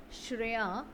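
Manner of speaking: ordinary talk, nothing marked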